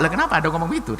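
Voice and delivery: usual speaking voice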